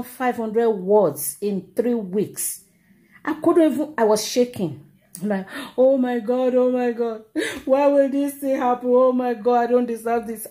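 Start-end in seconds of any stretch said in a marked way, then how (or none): 5.8-10.5 mock crying lament. Student wailing over her lost dissertation, mock-tearful lament. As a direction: act out a tearful plea to heaven. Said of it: "I was crying".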